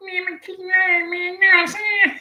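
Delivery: high squeaky mock laugh; a squeaky little laugh like on helium. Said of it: "swallows some helium gas every time he talk".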